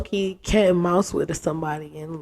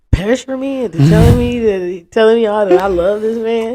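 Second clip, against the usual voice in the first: louder, higher, protesting retort; snapping back at the friends pressing her, louder; indignant; 4 semitones higher, 9 dB louder than usual. Retold or imitated. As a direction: push back, offended at the pressure.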